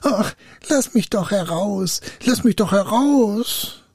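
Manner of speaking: high, plaintive wail; whimpering high spirit plea; pitiful spirit. A narrator's reading. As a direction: beg pitifully, stretching the last plea. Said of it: "der Geist rief ganz kläglich".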